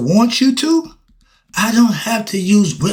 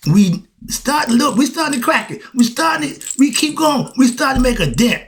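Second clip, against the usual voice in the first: much higher and rising, pushing harder; building rant; 9 semitones higher than usual. Real, not acted. Preacher denies ranting while his pitch climbs higher. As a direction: climb with urgency as the point lands.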